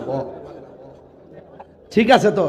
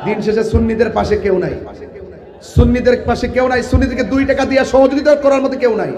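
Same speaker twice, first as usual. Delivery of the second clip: higher, louder sermon at full force; fiery preaching; 8 semitones higher, 9 dB louder than usual. Acted. Preacher denouncing politicians to the rally, shouting. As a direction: rouse the crowd, building to shouts.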